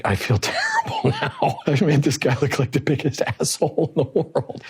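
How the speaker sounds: talking through real laughter; laughing. Real, not acted. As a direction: let laughter break into the words. Laughing at own embarrassing story.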